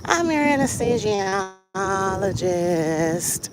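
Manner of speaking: put-on high, drawn-out old man voice; ancient anesthesiologist. Old doctor greeting his patient, ancient drawn-out voice. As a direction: imitate a very old man, stretching each word. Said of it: "this is exactly how he said it".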